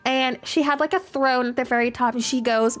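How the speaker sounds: usual speaking voice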